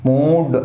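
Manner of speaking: మూడ్ with the oo stretched; long vowel. Shown as a demonstration. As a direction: draw out the middle of మూడ్. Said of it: "ఇది long pronunciation". (మూడ్ లో పొడవైన ఊ శబ్దం)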